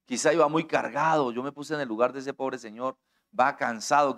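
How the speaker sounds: ordinary talk, nothing marked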